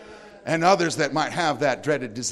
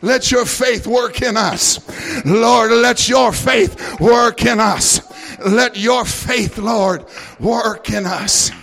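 loud, high, slow prayer; praying aloud over the music; fervent shouted prayer; 7 semitones higher, 9 dB louder, slower than usual. Acted. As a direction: cry out to God with full force.

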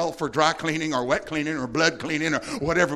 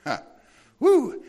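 ordinary talk

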